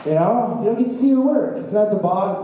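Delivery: usual speaking voice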